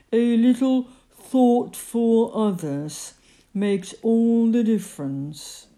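slow, drawn-out grumbling character voice; gloomy lecturing figure. A narrator's reading. Character lectures all of them about consideration, slow and reproachful. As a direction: lecture them slowly, dragging out the words.

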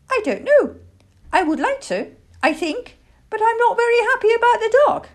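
put-on high, loud childlike voice, worried; hesitate, then blurt out the fear fast; anxious piping little owl; hesitant baby owl.

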